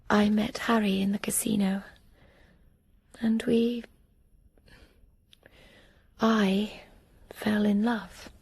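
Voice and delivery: hushed, halting whisper with pauses; whispered memory. Acted. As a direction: barely breathe the words. Whispered memory of falling in love.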